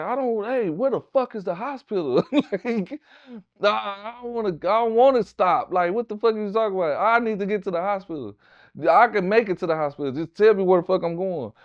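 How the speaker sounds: high, pressed and urgent, shot and desperate; frantic plea. Retold or imitated. Shot man begs the dispatcher, frantic. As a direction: beg for the hospital, rushing.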